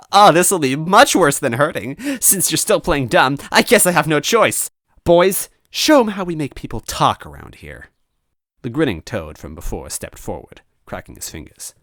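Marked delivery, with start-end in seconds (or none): 0.1-7.9 loud, high sinister boss voice; gloating villain. Acted. Villain ordering his men, loud and gloating. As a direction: order it with relish.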